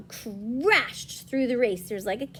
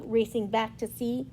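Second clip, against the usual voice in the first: ordinary delivery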